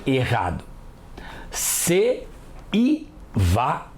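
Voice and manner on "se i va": "Se, i, va" split into three beats; wrong pronunciation. Shown as a demonstration. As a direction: chop "Se, i, va" into separate syllables, deliberately wrong. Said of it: "Ninguém fala assim". (pronúncia errada "Se, i, va", sílabas separadas)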